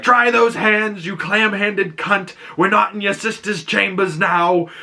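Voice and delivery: crude knight. Retold or imitated. Sir Lionel yelling at Dunk during tug of war, mock bellow. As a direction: ham up a rowdy knight bossing his anchor.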